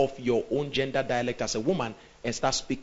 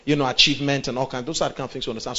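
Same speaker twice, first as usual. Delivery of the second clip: ordinary talk, nothing marked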